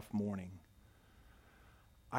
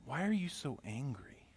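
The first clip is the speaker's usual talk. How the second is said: a quiet voice in his head, much softer; soft inner voice; 7 dB quieter than usual. Retold or imitated. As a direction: gently ask it like a voice inside. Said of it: "I hear this voice in my head".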